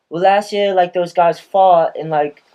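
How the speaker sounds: put-on a mild go at an Australian boy's accent. Imitating the Australian kid who asked about the toss, light Australian accent. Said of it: "I can't do a good Australian accent".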